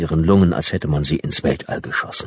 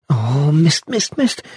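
rising, higher with each mist; dismayed cursing; 10 semitones higher, slower than usual. A narrator's reading. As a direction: curse in growing dismay. Sees her reflection, dismayed.

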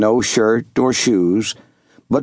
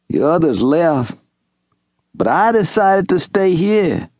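higher, lightly voiced old Southern servant; old servant calling out; 6 semitones higher than usual. Old servant Wash hailing young Master Jubal, lightly voiced. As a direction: call across the clearing in a gentle old man's voice.

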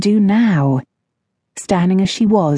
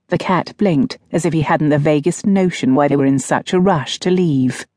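usual speaking voice

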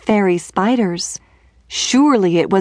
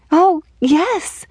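excited cry; 8 semitones higher than usual. A narrator's reading. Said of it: "cried she".

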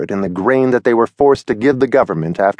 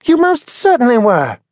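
put-on high young bird Henry, insisting; Henry pressing the point, voiced high; Henry contradicting Billy, in a high bird voice; Henry's voice; 12 semitones higher than usual. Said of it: "said Henry".

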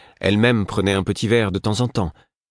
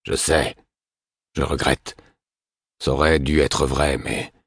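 lower, rough and crushed; a lightly voiced weary drunk; hoarse, weary man; 3 semitones lower than usual. A narrator's reading. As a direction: speak low like a man worn down by guilt. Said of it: "La voix de Burick était rauque et son ton accablé".

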